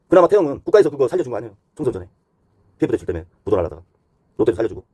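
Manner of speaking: ordinary talk, nothing marked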